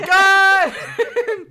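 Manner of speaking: high, loud laughing yell; laughing shout. Real, not acted.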